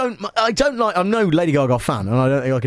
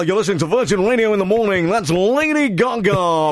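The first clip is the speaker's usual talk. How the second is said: radio presenter voice, pitched up; 7 semitones higher than usual. Retold or imitated. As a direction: announce like a breakfast DJ. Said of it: "put on my D J voice".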